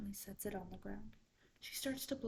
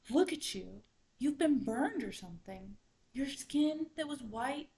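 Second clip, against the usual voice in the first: loud, lifted exclamation; shocked animals; 4 semitones higher, 8 dB louder than usual. A narrator's reading. Animals to the burned raccoon, loud astonishment.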